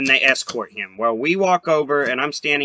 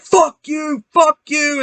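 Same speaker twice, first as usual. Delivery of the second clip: high angry yell of fuck you; shouted insult; 10 semitones higher, slower than usual. Retold or imitated. Rival driver yelling across the pits at them. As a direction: hurl it at him like a heckler. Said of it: "And then he's like".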